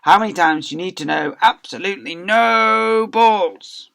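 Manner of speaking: a loud, high cross teacher, the last words slowed; booming outraged teacher, dragging out absolutely no balls; angry teacher. Acted. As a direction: rant at the kids like a furious schoolmaster.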